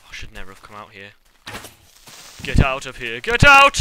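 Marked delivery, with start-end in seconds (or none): 2.4-3.8 loud, rising battle yell; hammed-up Boromir shout; Boromir line. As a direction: roar it like a desperate warrior. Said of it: "A classic Boromir line".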